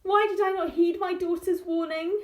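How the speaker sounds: high, pleading lament; mother laments ignoring her daughter's warning; regretful lament.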